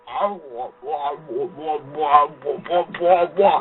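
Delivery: low mock wah-wah noises; low muffled speaker babble; imitating garbled audio. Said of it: "That's how it sounds".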